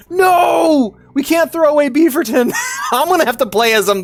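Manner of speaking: high, loud no, pleading; outraged high protest; protesting shout. Real, not acted. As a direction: cry out to save the character. Friend wants to drop Beaverton.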